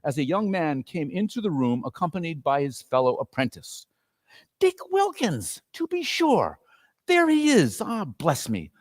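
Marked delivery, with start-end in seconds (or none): 4.6-8.7 much higher, fond excited recognition; thrilled to see his friend; delighted Scrooge. Acted. Scrooge delighted seeing old friend.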